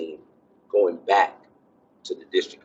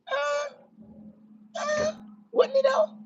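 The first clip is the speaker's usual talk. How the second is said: much higher mock falsetto, hesitant; doubting inner voice; 5 dB quieter than usual. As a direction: squeak it out like a suspicious inner voice.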